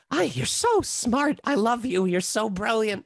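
high, slow, doting voice to his lamp; lonely villain. Shown as a demonstration. Awful Alvin lovingly praising his lamp, high and slow. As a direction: croon fondly to a lamp. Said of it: "And he's like".

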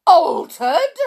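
loud, high outraged question; incredulous shout. A narrator's reading. Father hears his son has been altered, shouting. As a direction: shout it back in disbelief. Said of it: "shouted Mister Jenkins".

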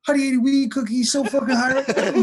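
loud, high-pitched imitation breaking into laughter; gleeful retold blurt, very high; mock announcing shout. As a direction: yell it out to the whole room. Brother announces the text to everyone.

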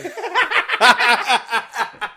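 high, loud burst of laughter. Real, not acted. Friend cracking up in a heated political chat, loud high laugh. Others crack up mid-argument.

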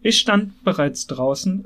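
usual speaking voice

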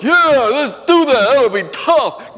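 excited mock yell, slowing at the end; eager friend. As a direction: play the kid talking everyone into it, loud and eager. Acting out eager friend urging him.

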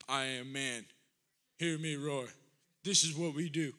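low, deep caveman-style husband; mock macho man. Mocking a macho husband's stance.

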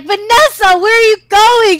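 high, loud shout of disbelief; exasperated yell. Retold or imitated. Squad on voice chat to early-jumping sister, exasperated yell. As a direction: imitate a squad yelling after a teammate.

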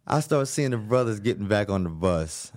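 ordinary delivery

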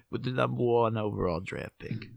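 mock New York accent announcing a pick; joking put-on New York accent; put-on sports draft accent. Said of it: "I don't know why they have that accent".

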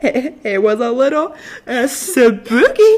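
slow, high, wavering spooky voice. Pretend. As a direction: stretch it out like a ghost story. Echoing spooky voice.

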